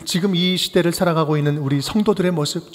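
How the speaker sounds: ordinary talk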